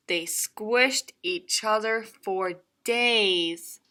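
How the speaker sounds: plain reading voice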